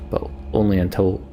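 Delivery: ordinary talk, nothing marked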